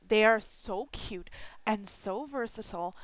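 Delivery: ordinary delivery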